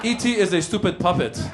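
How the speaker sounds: put-on commanding dad voice with a Persian accent; father like Darth Vader. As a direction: intone it like a movie villain father. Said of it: "my father's voice, like Darth Vader".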